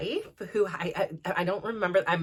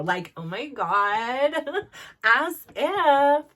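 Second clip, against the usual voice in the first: put-on higher, louder valley girl gush with a laugh; 7 semitones higher, 4 dB louder, much slower than usual. Shown as a demonstration. Showing viewers her teen Valley girl talk, hammed-up and louder. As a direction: play up the Valley girl lingo, mock-breathless. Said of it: "I'm a California Valley girl".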